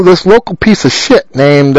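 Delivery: normal talking voice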